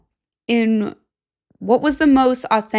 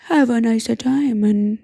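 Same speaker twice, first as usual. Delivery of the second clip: put-on sleazy old gentleman voice, partly whispered, drawn out slowly; creepy dandy voice. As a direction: play a leering old gent with a cane, purring compliments. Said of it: "he should be a guy that's going".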